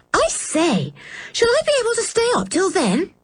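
put-on high, quick, eager little girl; Anne's voice bright with delight at staying up; pleased. A narrator's reading. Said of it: "said Anne, pleased".

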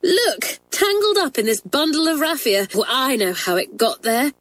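high-pitched schoolgirl discovery; excited cry. A narrator's reading. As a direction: show off the find in an eager, high voice. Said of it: "cried Hilary a little later".

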